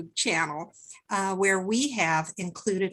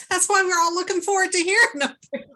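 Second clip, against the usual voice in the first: laughing; 9 semitones higher, 6 dB louder, much faster than usual. Real, not acted. Laughing after joking about age.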